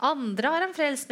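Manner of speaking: high, loud, slow sneer; mocking scorn. Chief priests scorning Jesus on the cross, loud sneer. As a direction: scoff at the crucified man. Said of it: "hånte også overprestene han".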